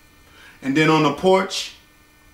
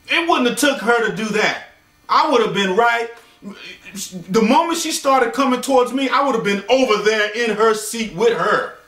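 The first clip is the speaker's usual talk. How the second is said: ordinary talk, nothing marked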